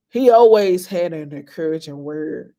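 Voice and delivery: ordinary talk, nothing marked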